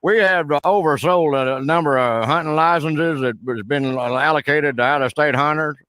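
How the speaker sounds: hammed-up backwoods Southern caller; put-on rural Southern accent. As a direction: play a blustering Southern good old boy on the phone. Roy Mercer character voice held.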